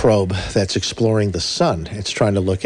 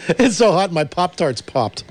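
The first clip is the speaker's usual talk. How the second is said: brash vaudeville comic; 6 semitones higher than usual. Acted. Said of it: "Rodney Dangerfield".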